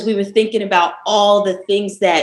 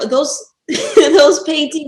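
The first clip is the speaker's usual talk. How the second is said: laughing; 6 semitones higher, 5 dB louder, slower than usual. Real, not acted. Laughing about abstract art.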